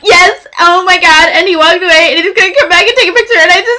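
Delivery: high excited babble speeding up; frenzied, high-register fan freakout; hysterical fangirl. Retold or imitated. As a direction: gush out of control into the phone. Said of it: "lower the register maybe a little bit".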